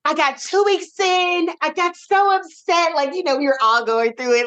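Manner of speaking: high, fast rush of frustration; mock exasperated rant; retold upset. Retelling how upset she got.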